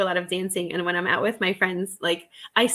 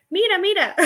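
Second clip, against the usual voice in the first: playful; 12 semitones higher, 3 dB louder than usual. Pretend. Playfully showing her new word.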